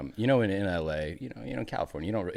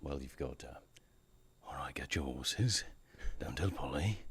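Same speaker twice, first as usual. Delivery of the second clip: put-on whispered, lower Birmingham gangster accent; Peaky Blinders accent; 4 semitones lower, 11 dB quieter than usual. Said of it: "the Peaky Blinders".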